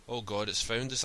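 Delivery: ordinary delivery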